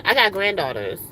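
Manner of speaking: usual speaking voice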